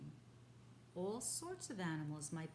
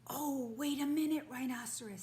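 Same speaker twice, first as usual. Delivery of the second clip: higher, louder quick plea; urgent plea; 6 semitones higher, 9 dB louder than usual. Acted. Father stopping the rhinoceros, urgent. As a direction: stop him before he acts.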